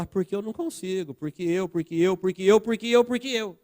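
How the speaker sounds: stuck-record excuses climbing in pitch; mock excuse-making. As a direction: imitate someone piling up lame excuses. Acts out someone making endless excuses.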